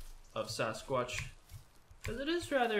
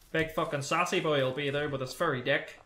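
usual speaking voice

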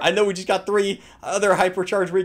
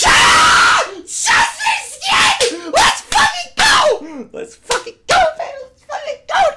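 much higher and louder, screaming with joy; ecstatic hype shouting; 14 semitones higher, 3 dB louder than usual. Real, not acted. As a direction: yell like your favourite just won. Hype over the new skin.

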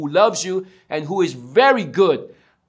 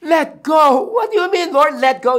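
high, alarmed questioning, acted; shocked disbelief; 8 semitones higher, 4 dB louder than usual. Frightened man questions God's instruction, acted shrieked disbelief. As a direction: balk at it in a leaping high voice.

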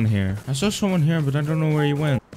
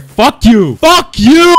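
very high, loud screamed insult; furious shout; 12 semitones higher, 12 dB louder, slower than usual. Real, not acted. Gamer screaming at the player who killed him, furious. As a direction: yell it at him in rage.

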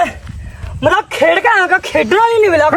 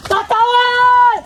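drawn-out call across the field, pitched higher; long higher call to uncle across the fields; 4 semitones higher, much slower than usual. Acted. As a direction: call the uncle from far off, stretching the words. Boy calls uncle from afar.

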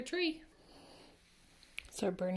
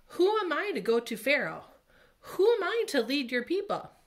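fearful protest; 5 semitones higher, 4 dB louder than usual. Acted. Moses afraid before God.

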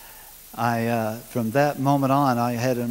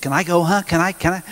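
eager begging; 6 semitones higher, 5 dB louder, faster than usual. Retold or imitated. Begging Roger to take him to Geneva, eager and loud. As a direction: pitch up and louder, eager like a kid.

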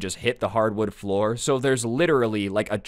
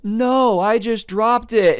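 put-on much higher and louder, slowed, childish protest; whiny kid denial; 11 semitones higher, 6 dB louder, slower than usual. Kid lying to his mom about the iPad, whiny denial. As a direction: play a guilty kid insisting on a lie.

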